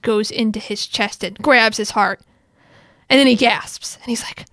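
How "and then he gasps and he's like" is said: ordinary talk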